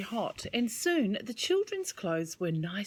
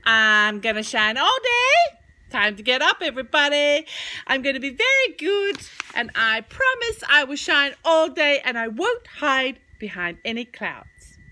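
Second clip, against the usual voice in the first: loud, higher, bouncy sun voice; cheerful sun; 5 semitones higher, 10 dB louder than usual. Acted. Sun greeting everybody in the morning, loud and bright. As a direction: beam it out like the rising sun. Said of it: "The sun came up and said".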